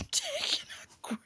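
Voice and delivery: breathy whispered aside. Real, not acted. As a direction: drop to a giggly whisper. Laughing aside about parasite.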